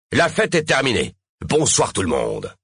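high start falling to a final goodnight; curt dismissal. A narrator's reading. Mayor sending the party crowd home, curt. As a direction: send everyone home, no appeal.

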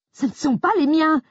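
high, loud, vehement denial; vehement protest. Acted. Grace protesting vehemently to Jack. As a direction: fling the denial back at him. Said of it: "ai-je protesté avec véhémence".

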